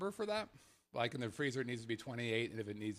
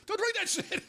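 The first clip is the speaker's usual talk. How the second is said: high, loud laughing warning; laughing shout; 5 dB louder than usual. Real, not acted.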